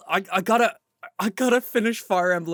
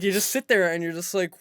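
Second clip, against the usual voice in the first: normal talking voice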